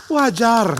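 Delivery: high, loud mock delight on wajar; a wife praising a child's finished chore, high and loud. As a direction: cheer "wajar" with playful delight. Wife's exaggerated approval.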